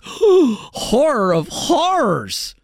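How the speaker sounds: slow, high, hammed-up gasp of horror; mock gasp of horror. Host mocking the university's outrage, hammed-up gasp. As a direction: clutch your pearls in fake dismay. Mock shock at the ranking drop.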